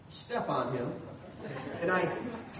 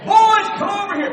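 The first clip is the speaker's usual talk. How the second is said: put-on loud, high shout to the kids; big excited retold yell; screamed call; 8 semitones higher, 11 dB louder than usual. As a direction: holler across the neighborhood. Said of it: "and he screamed".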